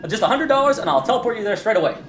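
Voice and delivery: high, eager pitch; pushy hawker. Acted. Hawker offering a teleport pod, high and eager. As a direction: close the sale.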